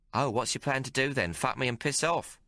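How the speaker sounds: a streetwise barmaid teasing the hero; Maggie's voice. Acted. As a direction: play her worldly and mocking. Maggie mocks Hawk's plan.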